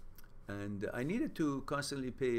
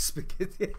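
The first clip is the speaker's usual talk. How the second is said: reading through laughter; real laughing; 9 semitones higher, 6 dB louder than usual. Real, not acted. Reading Timmy's comment to viewers, breaking into laughter. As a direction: crack up as you read it out.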